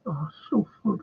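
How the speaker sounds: normal talking voice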